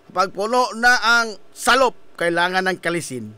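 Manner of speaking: lightly voiced movie tough-guy line; FPJ. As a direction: quote it low, dropping further at the end. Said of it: "F P G kanya to". (medyo ubos nga tingog sama sa bida sa aksyon)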